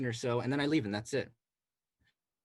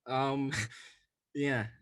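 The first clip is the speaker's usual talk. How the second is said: ordinary talk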